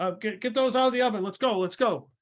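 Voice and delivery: high, loud, fast hurrying; urgent kitchen order. Acted. Baker rushes staff to empty the oven, urgent. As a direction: hurry the staff along, loudly.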